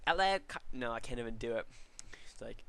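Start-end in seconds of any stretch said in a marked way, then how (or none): 0.1-0.6 high, loud attempt at a friend's voice; half-finished hammed-up imitation; Geto's voice. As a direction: try on his voice and break off. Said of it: "No, i-, in his voice".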